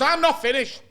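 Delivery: high, loud, indignant cut-in; mock angry interruption. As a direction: cut him off like a fuming flatmate. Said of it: "he's just ranting at me and he's screaming".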